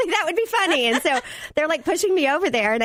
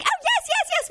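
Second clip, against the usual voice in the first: very high, squeaky yes yes yes; mock-flustered high squeak; flustered surprise. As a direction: caught off guard and tickled. Flustered reply about birthday.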